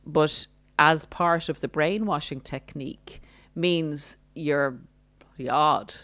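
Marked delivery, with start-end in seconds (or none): none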